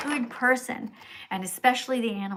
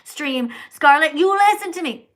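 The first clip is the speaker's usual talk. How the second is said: high, much louder pretend rant; mock furious yell; 8 semitones higher, 10 dB louder than usual. Streamer fake-yelling at a viewer she never forced to watch. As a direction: bark it like dragging someone in by the hair. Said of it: "and saying".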